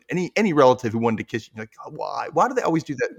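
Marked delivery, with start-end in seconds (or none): 1.8-3.2 higher-pitched complaint; exaggerated childish dismay; mock whining. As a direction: whine it like a put-upon kid. Kid dreading relative's kiss.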